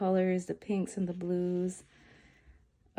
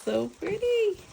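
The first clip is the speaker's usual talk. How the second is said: high, loud squeal of delight; 13 semitones higher, 5 dB louder than usual. Real, not acted. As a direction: gush like you just saw treasure. Gushing over confetti.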